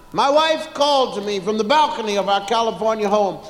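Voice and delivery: normal talking voice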